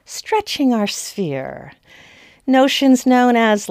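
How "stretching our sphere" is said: mock arguing women. Historian voicing women who argue they stay in their sphere, higher mock-reasoning voice. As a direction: play innocent, stretching out the last words. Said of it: "tried to argue things like".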